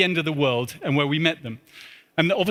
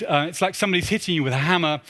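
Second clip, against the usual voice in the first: usual speaking voice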